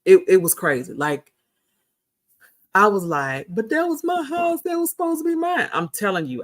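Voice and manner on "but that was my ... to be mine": high-pitched mock crying; tearful high whine, put on; crying retold. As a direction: wail it like a heartbroken child, hammed up. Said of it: "I was crying".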